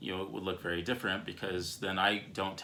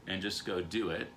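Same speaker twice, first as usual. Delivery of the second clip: normal talking voice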